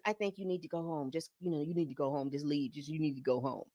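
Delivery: quietly steer her out the door; calm de-escalating. Retold or imitated. Rehab Bae telling Lisa to leave, low soft firm urging.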